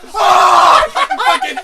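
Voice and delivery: very loud, high mock yell; explosive hammed-up "Oh!"; triumphant roar. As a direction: let it rip, wildly loud. Acts out diving back in.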